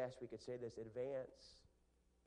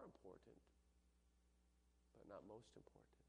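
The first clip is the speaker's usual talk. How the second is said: quiet intense preaching; 3 semitones lower, 13 dB quieter than usual. Real, not acted. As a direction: pull them in, almost hushed.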